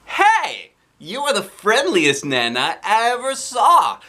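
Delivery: high, loud, thrilled praise; delighted. Acted. Girl to the Nana, high loud delighted gushing. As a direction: gush over the fun grandma.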